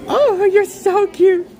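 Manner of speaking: high, slow gushing coo; adoring coo. Real, not acted. Cooing at something cute like the dog, adoring. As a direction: stretch it out high, utterly charmed.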